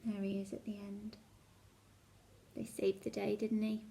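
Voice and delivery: hushed whisper of suspense; whispered aside. Acted. Storyteller whispering suspense to children. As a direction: breathe it like a secret.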